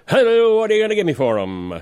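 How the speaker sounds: fast auctioneer patter. Pretend. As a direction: rattle it off like an auctioneer. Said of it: "I could see you being an auctioneer".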